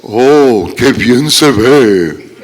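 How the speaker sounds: slow, drawn-out mock admiration; stretched-out, put-on compliment; mock admiring mourner. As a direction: imitate a funeral guest cooing over the coffin. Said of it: "Y no digas".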